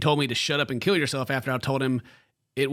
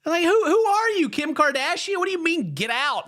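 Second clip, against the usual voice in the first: high, mocking disbelief; incredulous; 14 semitones higher, 4 dB louder than usual. Real, not acted. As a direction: scoff at it in a high voice. Mocking the worried girlfriend's fear.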